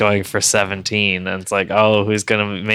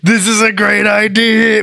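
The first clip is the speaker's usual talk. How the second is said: loud, high mocking shout; mock enthusiasm; 11 semitones higher, 5 dB louder than usual. Teasing his friend, a loud high mock-excited shout. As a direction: shout it like a fanboy sold on the idea.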